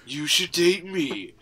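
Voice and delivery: slow, whispery movie trailer narrator; drawn-out, hammed-up trailer pitch; mock trailer voice. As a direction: play the trailer announcer as a seducer. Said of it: "my trailer voice".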